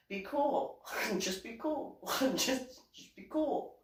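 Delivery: high, small, halting plea; pleading for calm. Retold or imitated. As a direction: act nervous begging between giggles. Pleading with men to stay calm.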